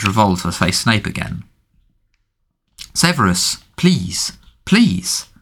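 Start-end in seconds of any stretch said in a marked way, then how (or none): 2.9-5.3 pleading, rising higher to the last please; desperate pleading. A narrator's reading. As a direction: beg desperately, voice climbing. Captive begging for her life.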